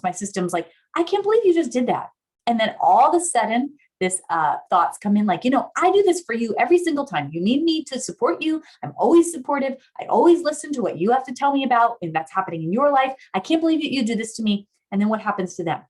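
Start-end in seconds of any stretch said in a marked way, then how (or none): 0.9-2.1 high, indignant acted protest; activated outrage. Hurt partner reacting to the phone glance, indignant. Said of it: "big activated m-, response". 5.4-14.7 high, insistent tirade of grievances; activated indignation. Acted. Hurt partner's thoughts after being ignored, indignant. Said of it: "big activated m-, response".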